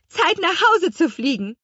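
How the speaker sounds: high, brisk announcement to passengers; calling out. A narrator's reading. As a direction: call it out with lifted pitch. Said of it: "rief die Apfelhexe". (hoch ausgerufen)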